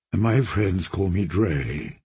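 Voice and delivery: put-on friendly young lord, deep and relaxed; low, slow, courteous knight; genial knight. Dre voiced in the story.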